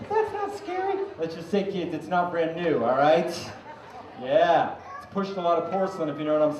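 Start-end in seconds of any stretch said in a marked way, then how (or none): 0.1-1.0 mock kid. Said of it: "some of the kids are laughing".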